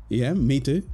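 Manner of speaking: usual speaking voice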